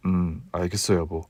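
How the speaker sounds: a husband admitting he was fooled; low, soft apologetic husband voice; sheepish husband. Acted. Husband agreeing.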